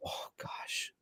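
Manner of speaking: breathy whisper of disbelief; barely voiced, half to himself; whispered exasperation; whispered oh gosh. Real, not acted. Exasperated reaction to team question.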